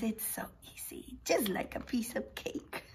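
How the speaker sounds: hushed whisper explaining a secret. Real, not acted.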